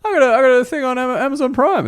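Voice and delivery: high-pitched, hurried mock boast; mock credit bragging. Comic boasting to unimpressed locals about a credit, mock high brag. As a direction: show off in a silly high voice.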